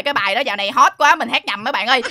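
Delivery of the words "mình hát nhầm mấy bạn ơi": normal talking voice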